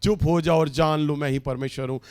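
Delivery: rattled off fast, as if it meant little; चुप हो जाओ read quickly and offhand, no weight; quick casual reading. Shown as a demonstration. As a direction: toss the verse off lightly. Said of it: "ओ, प्रभु इस बात को यूं ही शायद बोल रहे हैं".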